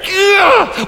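high hammed-up groan of despair; mock groan. Preacher's hammed-up groan after the mock confession. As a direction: let out an overdone ugh.

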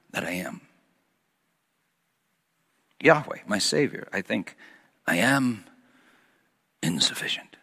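dropping to a near whisper; softly fading into a whisper; hushed confession. Real, not acted. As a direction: let the voice sink away to nothing. Confessing inadequacy, voice sinks to whisper.

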